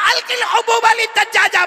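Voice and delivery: high, loud angry cry; angry mother. Retold or imitated. Old mother angrily shouting at her son.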